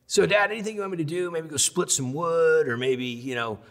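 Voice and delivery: high, mock-innocent offer; high sarcastic son taunting his father; sarcastic kid. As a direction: needle dad with fake helpfulness. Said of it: "And I'm like".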